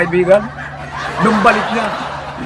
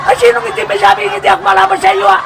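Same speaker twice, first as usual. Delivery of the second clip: much higher, indignant buddy voice; challenging barkada; 13 semitones higher, 3 dB louder than usual. Acted. Friend insisting he's not afraid of the lister, high and indignant. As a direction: bristle at being called a coward.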